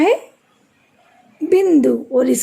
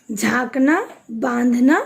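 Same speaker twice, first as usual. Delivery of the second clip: ordinary talk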